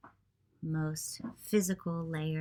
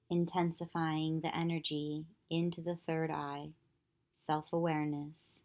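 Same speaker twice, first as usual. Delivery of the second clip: calm, even meditation voice with long pauses; unhurried yoga nidra guidance, leaving long silences; guided meditation; 3 dB quieter than usual. Acted. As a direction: guide them gently into stillness, phrase by phrase.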